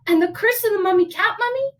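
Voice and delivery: put-on high storybook mother lecturing her boy; disapproving mama. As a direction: warn a child off it dramatically.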